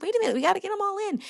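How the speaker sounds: high, fast mock-frantic outburst; retold frantic protest. Racer protesting to the student who runs off, mock frantic. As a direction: blurt it out high and quick.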